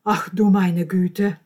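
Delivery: slow, very loud exclamation; appalled grandmother. Acted. Grandmother hears of the scandal, loudly appalled. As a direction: drag out the dismay loudly.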